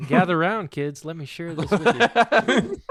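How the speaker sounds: hammed-up gather round storyteller. Mock storyteller opening to friends.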